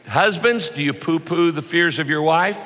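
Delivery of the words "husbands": ordinary delivery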